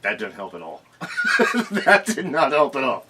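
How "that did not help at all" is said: laughing. Real, not acted. Laughs at unhelpful hint.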